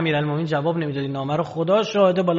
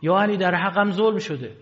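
retold cry for justice; 5 semitones higher, 5 dB louder than usual. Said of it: "اومد داد زد".

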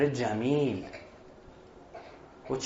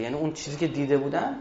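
ordinary delivery

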